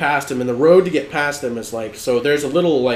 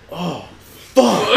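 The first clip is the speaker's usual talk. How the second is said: dawning horror, imitated; 5 semitones higher than usual. Friend realizing it was a search party, stunned. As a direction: let the realization land, then burst.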